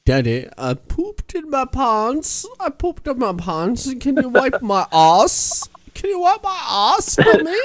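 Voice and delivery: hammed-up whiny American child; whiny kid. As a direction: plead like a helpless little kid. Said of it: "American kid's like".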